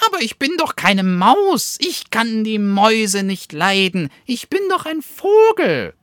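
very high, loud squeaky protest; insisting bat. A narrator's reading. Bat protesting to the second weasel that it's a bird, high squeaky insistence. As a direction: squeal that you are a bird, not a mouse. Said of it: "beteuerte die Fledermaus".

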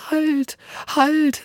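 high, pleading puppy voice; squeaky dog begging for mercy; pleading little dog. Acted. Frightened little dog begs the hungry wolf, high and pleading.

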